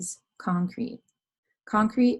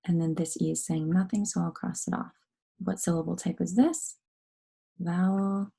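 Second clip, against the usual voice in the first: ordinary delivery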